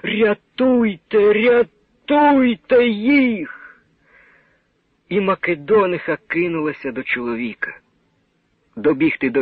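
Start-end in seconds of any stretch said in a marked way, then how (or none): 0.0-3.6 high, drawn-out slow wail; desperate cry. A narrator's reading. Woman in the crowd begging, desperate slow wail. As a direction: cry out in despair for them. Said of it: "отчайдушний жіночий крик".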